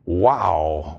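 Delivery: low, hushed wow of amazement; awed wow. Real, not acted. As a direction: let the wonder sink in low. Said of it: "I say again".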